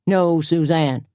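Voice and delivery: slow firm caution; calm her down. A narrator's reading. Doogie restraining Suzanne, slow cautioning voice. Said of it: "cautioned Doogie".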